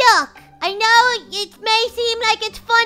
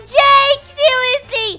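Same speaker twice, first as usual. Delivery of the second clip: raised, louder scolding shout; exasperated call; 6 semitones higher, 6 dB louder, much slower than usual. Acted. Friend scolds the runaway boy, exasperated shout.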